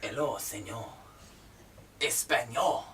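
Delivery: low-pitched mock-Spanish greeting; brief stab at Spanish; Spanish accent. Shown as a demonstration. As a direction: put on a simple Spanish accent.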